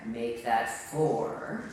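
a deadpan correction, low and unhurried; low wry aside. Acted. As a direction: drop low and slow for a dry aside.

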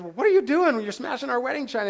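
high and fast, startled and bewildered; shocked husband. Retold or imitated. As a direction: blurt it out in alarm at the smashing. Husband shocked by wife smashing china.

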